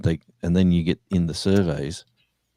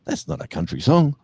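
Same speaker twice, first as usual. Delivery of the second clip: station owner; 9 semitones higher than usual. Retold or imitated. Station owner voiced in anecdote.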